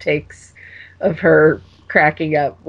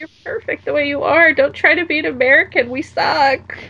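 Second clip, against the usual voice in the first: high-pitched, exaggerated fan plea; hammed-up pleading, pitched way up; mock pleading; 11 semitones higher than usual. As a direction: beg her in a high, playful voice. Acted plea to the actress.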